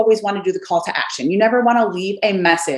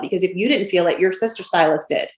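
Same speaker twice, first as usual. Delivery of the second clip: usual speaking voice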